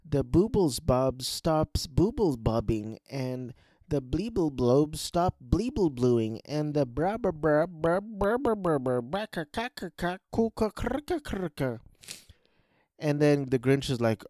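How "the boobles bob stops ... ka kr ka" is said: silly gibberish climbing higher and higher; hammed-up tongue-twisting nonsense; babbling nonsense character. Nonsense speech of a story character.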